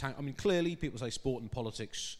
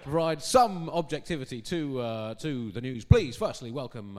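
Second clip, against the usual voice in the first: ordinary delivery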